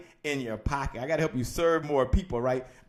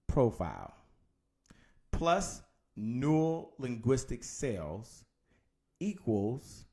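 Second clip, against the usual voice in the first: drawn-out terms, long pauses between them; slow dictation; 3 semitones lower, 4 dB quieter, slower than usual. Shown as a demonstration. Coach dictating the formula to listeners, slow and spaced. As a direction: lay out each term separately, unhurried.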